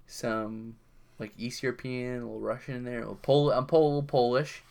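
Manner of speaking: ordinary delivery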